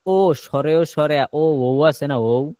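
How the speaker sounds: ordinary delivery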